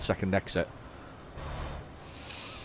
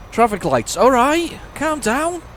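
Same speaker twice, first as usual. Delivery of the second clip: high, loud startled "All right. Calm down."; 13 semitones higher, 5 dB louder, slower than usual. Real, not acted. Driver startled at the traffic lights, high surprised yelp. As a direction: squeak it out, caught by surprise. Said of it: "driver reacting to traffic lights".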